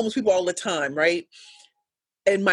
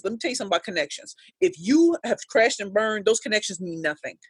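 normal talking voice

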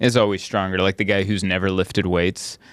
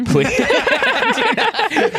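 high, laughing plea; mock pleading; 3 dB louder, slower than usual. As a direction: beg through laughter, pitched high. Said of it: "you're like".

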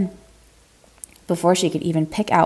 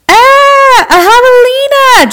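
high, loud shriek of alarm; startled hiker screaming in fright; panicked shout; 12 dB louder, much slower than usual. Acted. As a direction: shriek it like a wild pig is charging you. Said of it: "Julie shouted".

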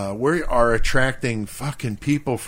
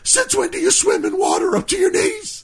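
much higher mock shrill shout; high-pitched shrill voice. Imitating Lenny yelling at the swimmers, shrill. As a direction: shriek it like a friend losing it. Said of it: "the high pitch fucking voice".

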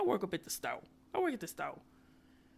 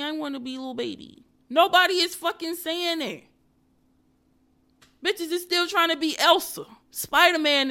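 exasperated rant; 6 semitones higher, 5 dB louder than usual. Real, not acted. Ranting about kids' costumes.

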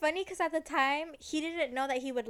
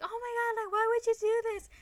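hammed-up angry girl, pitched way up; mock outrage; 10 semitones higher, faster than usual. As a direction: tell him off after the splash. She's mad after the splash.

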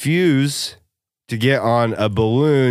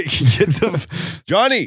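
high hammed-up shout at Johnny; mock angry yell; 7 semitones higher than usual. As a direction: yell at the intruder, half joking. Angry wedding guest yelling.

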